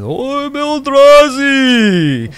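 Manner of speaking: huge, slow, drawn-out dragon roar of a name; the dragon announces itself to the gods, booming. Acted. GM voicing dragon's entrance.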